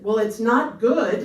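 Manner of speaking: loud, slow, drawn-out admission; blurted bad news. Stylist telling the client her hair is ruined, loud and slow.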